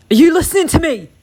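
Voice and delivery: loud, high, quick angry demand. Acted. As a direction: demand it as the venomous husband. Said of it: "he demands".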